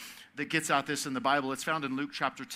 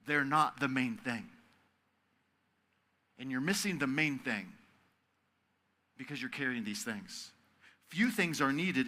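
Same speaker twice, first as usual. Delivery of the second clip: slow, soft and gentle; soft, near-whispered comfort; tender Jesus; 3 semitones lower, 4 dB quieter than usual. Retold or imitated. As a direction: lay it down softly for them. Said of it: "Jesus says to Martha".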